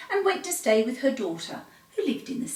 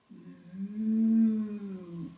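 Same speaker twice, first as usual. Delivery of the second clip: cow moo; 4 dB quieter than usual. Acted. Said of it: "answered Belinda".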